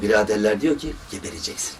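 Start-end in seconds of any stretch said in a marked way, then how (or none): 1.1-1.7 ominous whisper. Retold or imitated. American doctors' blunt verdict.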